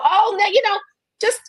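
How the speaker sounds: high, sing-song mock indifference; sarcastic mock. Mocking maga people who shrug it off, high and sarcastic. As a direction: put on a falsely cheerful high voice to mock them.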